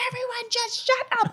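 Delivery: high-pitched mock scream; retold scream. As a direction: screech it as a frantic outburst, far above your normal pitch. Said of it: "I screamed".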